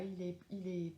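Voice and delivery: ordinary talk, nothing marked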